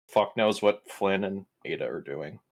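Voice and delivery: ordinary talk, nothing marked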